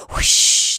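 breathy rushing whoosh; unvoiced whoosh of wind. A narrator's reading. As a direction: blow it out like a gust. Wind sound in story.

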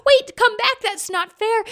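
high, loud calling; boy yelling come back after the wind. A narrator's reading. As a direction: shout after the wind, upset.

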